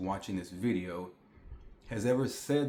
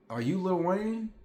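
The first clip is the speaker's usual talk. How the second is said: worker asking; 8 semitones higher than usual. Retold or imitated. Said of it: "he's like".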